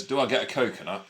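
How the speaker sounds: flat, straight-faced quote of the puppet; mock monotone puppet line; deadpan imitation. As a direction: say it dead straight like a stiff voice actor. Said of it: "then says".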